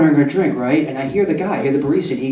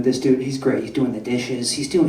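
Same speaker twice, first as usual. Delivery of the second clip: ordinary talk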